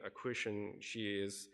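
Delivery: normal talking voice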